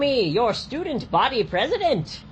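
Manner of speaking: loud, grand royal command; commanding decree. Pretend. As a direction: demand it like a king.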